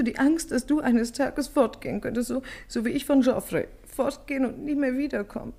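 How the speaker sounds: ordinary talk, nothing marked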